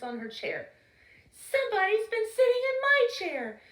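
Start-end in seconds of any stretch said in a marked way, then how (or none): 1.3-3.6 higher, sweet bear mother; sweet Mama Bear. Acted. Mama Bear noticing her porridge, sweet and high. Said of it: "she said in her sweet, low voice".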